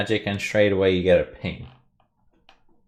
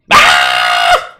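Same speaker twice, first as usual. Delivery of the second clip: loud, terrified creature scream; kobold scream; 8 semitones lower, 12 dB louder than usual. Acted. Kobold running from the dwarf's threat, screaming. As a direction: scream as you flee. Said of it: "screams and runs away".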